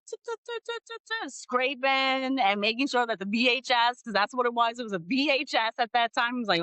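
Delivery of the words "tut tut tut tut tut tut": high soft tongue clicks; soft high tutting sound; mock tutting. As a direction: tut tut like a fussy tech. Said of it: "Einstein over there in the background, like".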